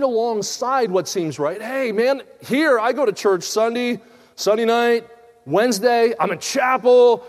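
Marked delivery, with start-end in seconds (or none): 1.6-7.2 higher and louder, a hammed-up self-satisfied churchgoer; mock proud churchgoer. Preacher mocking a smug churchgoer, loud and boastful. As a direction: brag about church attendance like a smug believer.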